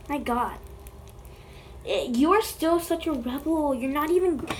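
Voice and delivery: ordinary talk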